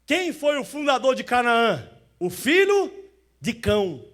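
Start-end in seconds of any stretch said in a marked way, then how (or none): 2.2-4.0 called out high and loud; loud answer. Acted. Preacher answering his own question, loud. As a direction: proclaim the answer to the church.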